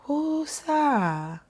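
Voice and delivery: whispered, airy and detached; mock serene whisper. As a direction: imitate the calm teachers, hushed. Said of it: "are they so, like".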